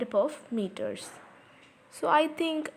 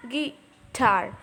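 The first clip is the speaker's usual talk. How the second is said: "guitar" split, a short gui, then a stronger tar; weak-strong syllables. Shown as a demonstration. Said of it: "tar is a strong syllable".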